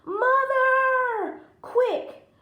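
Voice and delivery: high, loud urgent call; shouting. Acted. Jack shouts to his mother to bring the axe. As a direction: yell it to mother across the yard. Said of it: "he shouted to her".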